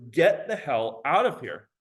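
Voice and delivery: usual speaking voice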